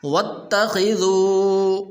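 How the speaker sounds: وَاتَّخِذُوا with the final waw stretched long; lengthened waw. Shown as a demonstration. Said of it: "واو مدہ ہے تو اس کو لمبا کرنا ہے". (وَاتَّخِذُوا میں واو کو لمبا کریں)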